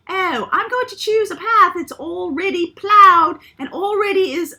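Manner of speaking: high, loud mock English old lady accent. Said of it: "That's, that's my old lady English voice".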